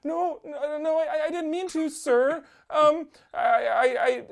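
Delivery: high-pitched panicky stammer; scared kid. Retold or imitated. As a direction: backpedal meekly as a frightened kid. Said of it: "I was too afraid".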